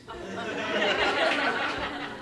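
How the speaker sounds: a burst of high giggling; high laugh. Real, not acted. Laughing at Pete walking down the hill, high giggle.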